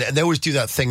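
usual speaking voice

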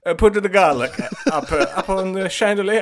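put-on high, rushed Swedish accent; high Swedish accent, hurried; hasty Swedish king. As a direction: Swedish accent like reading a note handed over. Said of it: "sort of hastily".

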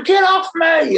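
high mock yell of get off me; high squealing mimicry; mimicking the guy. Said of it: "He was going".

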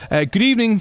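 bright high sing-song hello; sing-song greeting. Acted. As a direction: greet them like a cheery host.

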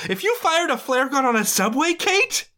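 high disbelieving scold; incredulous scold. Real, not acted. Scolding Kate on screen.

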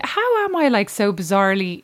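ordinary talk

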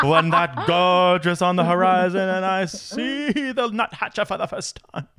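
slow, high-pitched, tearful wizard impression; hammed-up awestruck crying voice, words stretched out; Gandalf. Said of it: "What voice was that?".

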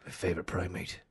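low, whispered Neeson menace; low whispered Liam Neeson threat; Liam Neeson impression. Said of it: "That's Liam Neeson".

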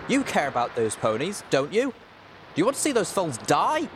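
heated, high challenge; cross shouting. A narrator's reading. Little Pip challenging Watcher to help the ponies, cross shouting. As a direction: push the pitch up into an accusing question. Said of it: "I shouted crossly".

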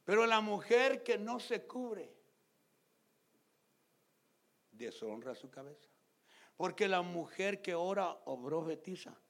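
loud, slow emphatic reading; solemn preached reading; scripture reading. Acted. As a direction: proclaim the verse to the church. Reads scripture forcefully.

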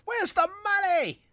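very high squeaky demand; kid's voice. Acted. Kid demanding money at the door, high squeak.

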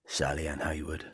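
low, quiet police arrest; stern detective making an arrest. Pretend. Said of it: "now be a detective".

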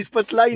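Spotlight said as "spot-line"; high teasing "spot-line" for Spotlight; imitated mispronunciation. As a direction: mimic the slip, Spotlight ending on N. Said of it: "tu dis Spotlight depuis tout à l'heure".

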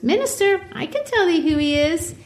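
put-on high little-girl voice; child Pearl. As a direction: sing it out like a sly child.

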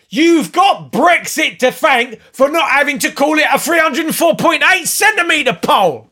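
high, shouted man-in-the-street tirade; mock angry rant. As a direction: rant indignantly at full pitch. Acting irate Brexit supporter ranting.

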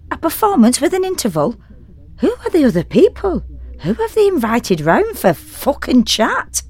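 worked-up comic tirade about the neighbours; rising exasperated rant. Real, not acted. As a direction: build the outrage line by line. Ranting about noisy neighbours.

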